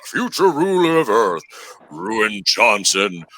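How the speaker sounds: hammy evil overlord proclamation; dollar store villain voice. Pretend. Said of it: "there's my dollar store dude talk".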